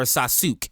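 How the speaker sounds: Sasuke cut to sah-SOOK; Sasuke as two syllables, sah-sook; mispronounced Sasuke. Shown as a demonstration. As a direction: end Sasuke on a k, no final vowel. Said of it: "You don't say".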